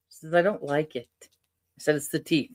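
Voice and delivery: usual speaking voice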